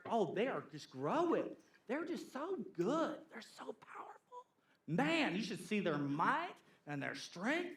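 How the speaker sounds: hushed, then whispered awe; awed gossip. Retold or imitated. Gossip telling the congregation about Tobiah's might, hushed and awed. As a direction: breathe it like a secret marvel.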